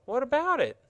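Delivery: put-on high, bright girl's voice, curious; a little girl asking eagerly; young girl Betsy.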